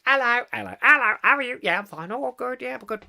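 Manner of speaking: high, silly exchange of hellos; mock gushing greetings. Host mocking two fans' love-in, high silly greeting voices. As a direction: put on twittery little voices for both sides.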